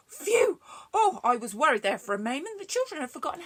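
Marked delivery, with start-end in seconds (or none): 0.1-0.6 sharp gasp then phew; gasp of relief. Real, not acted. Relief after story.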